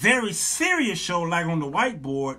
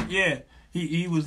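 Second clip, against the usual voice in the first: ordinary talk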